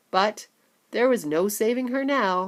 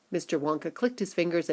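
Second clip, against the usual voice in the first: ordinary delivery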